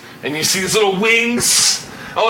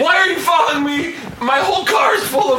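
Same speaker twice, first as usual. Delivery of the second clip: outraged driver; 6 semitones higher, faster than usual. Acted. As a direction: yell it at whoever is tailing you. Acted confrontation by panicked driver.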